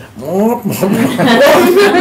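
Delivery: loud, high wordless squawk; Crabapple wah-wah noise. Retold or imitated. Man mimicking the yelling woman, loud wah-wah noise. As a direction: imitate droning wah-wah scolding. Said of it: "Missus Crabapple, you know, the, uh".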